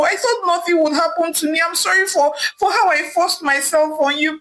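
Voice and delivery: high, urgent pleading; a man begging forgiveness from the woman he wronged; pleading Osaze. A narrator's reading. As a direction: beg her, voice pitched high and desperate. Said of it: "pleading with Ivie".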